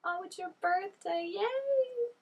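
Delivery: high, drawn-out birthday cheer; excited cheer. Acted. Reactor cheering at a show's birthday surprise, excited. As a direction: squeal a happy yay, held high and long.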